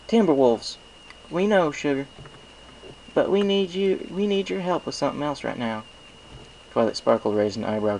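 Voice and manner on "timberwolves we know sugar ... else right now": higher, homey farm-mare voice; Applejack friendly. A narrator's reading. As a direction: cut in kindly but firmly.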